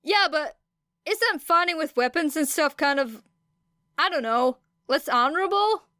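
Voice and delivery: put-on high, eager voice of a boy calling across the room; redhead calling out. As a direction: pitch it up and question them doubtfully.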